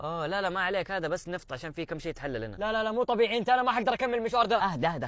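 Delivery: usual speaking voice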